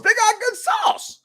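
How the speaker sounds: high, slow gushing; hammed-up praise. Real, not acted. Host gushing to the co-host about Sbarro sauce, high and drawn out. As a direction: gush about the sauce, stretched out.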